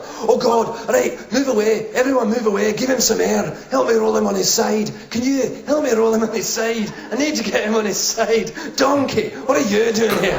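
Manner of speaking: high, urgent Scottish helper; hammed-up Scottish accent, taking charge. Bystander helping a collapsed man, urgent Scottish accent. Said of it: "I clearly have one Scottish accent".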